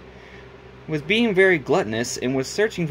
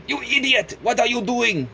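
much higher, indignant yell; angry rat yell; 9 semitones higher than usual. Acted. Rat yelling at Cuphead, high angry squeak. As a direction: snap at him in a high squeak. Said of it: "He said".

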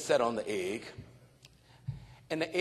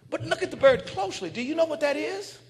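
high, eager pointing it out; insisting he look closer; urging excitedly; 10 semitones higher, 3 dB louder, faster than usual. Retold or imitated. Man urging the farmer, excited.

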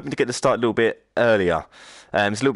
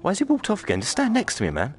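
exasperated, high; 9 semitones higher than usual. Real, not acted. Annoyed at character walking off.

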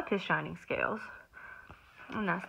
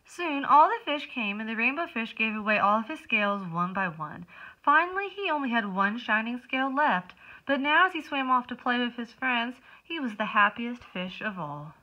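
lively storybook narration; reading to children; 4 semitones higher, 4 dB louder than usual. A narrator's reading. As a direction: read it like circle time. Reads picture book aloud.

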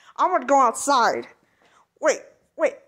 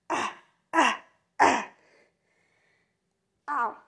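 normal talking voice

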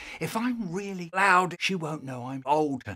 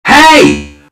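very loud, high yell; sudden shout; 13 semitones higher, 23 dB louder than usual. Acted. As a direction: call out to grab attention. Sudden call out.

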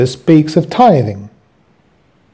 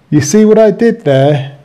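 raised, teasing question to the listeners; pleased with own joke; 5 semitones higher than usual. Real, not acted. Preacher proud of his pun asks listeners, teasing. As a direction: nudge them to catch the wordplay. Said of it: "Yeah, I thought I was clever".

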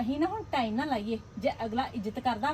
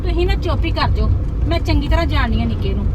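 loud retort; 10 dB louder than usual. Acted. Wife silencing husband about her brother, loud angry retort. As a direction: tell him off, loud and firm.

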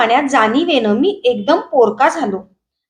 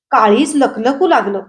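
ordinary delivery

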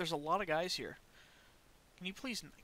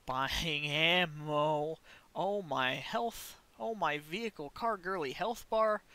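ordinary talk, nothing marked

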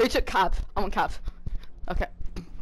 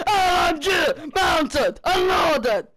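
higher, slow, shouted soldier callout; Chunker impression; 7 semitones higher, 4 dB louder, much slower than usual. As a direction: bark it like a gruff gunner. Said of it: "I can do it, Chunker".

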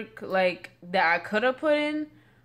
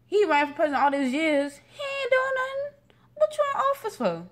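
sassy mock outrage; 13 semitones higher, 4 dB louder than usual. Real, not acted. Mocking rival, pitch jumps high.

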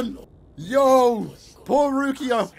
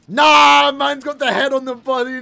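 loud, raised cry of disbelief; appalled shout; 3 semitones higher, 8 dB louder than usual. Real, not acted. Viewer appalled by a grim scene, shouting in disbelief. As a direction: shout your objection.

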